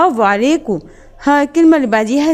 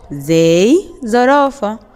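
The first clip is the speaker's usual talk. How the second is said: slow model word; much slower than usual. Shown as a demonstration.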